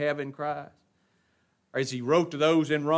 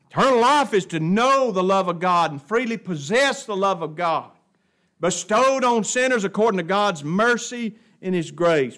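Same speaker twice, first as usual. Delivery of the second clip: raised, emphatic pulpit voice; full-force preaching; 7 semitones higher, 11 dB louder than usual. Real, not acted. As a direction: preach it hard to the congregation.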